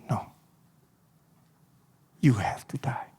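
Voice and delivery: low whispered verdict; hushed answer. Acted. God answers Jesus in Gethsemane, low whisper. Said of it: "Dan Tuhan jawab".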